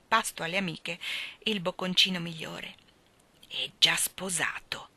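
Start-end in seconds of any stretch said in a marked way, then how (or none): 3.5-4.9 lento e sussurrato, scandendo ogni sillaba; sillabando lentamente. A narrator's reading. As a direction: gusta la notizia, parola per parola. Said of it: "sillabò lentamente". (sussurro lento e scandito)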